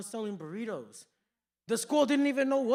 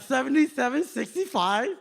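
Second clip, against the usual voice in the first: loud, higher slur through braces; mock headgear speech; 4 semitones higher, 8 dB louder than usual. Joker echoing the worker's total, mocking headgear voice. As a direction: mimic a headgear wearer loudly. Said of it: "do the exact same".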